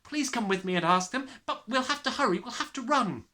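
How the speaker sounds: put-on high, loud, breathless child; hurry, hurry; a girl begging her to run; urgent pleading. A narrator's reading. Child urging teacher to hurry.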